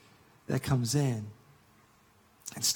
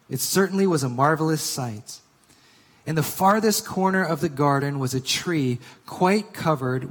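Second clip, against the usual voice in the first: storybook read-aloud; story reading; 5 dB louder than usual. Acted. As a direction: read it like a beloved tale, swelling with wonder.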